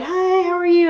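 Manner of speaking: high-pitched, sing-out mock greeting; put-on high, chirpy voice; imitated cheery greeter. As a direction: imitate an overly friendly hello. Imitating favorites greeting her cheerfully.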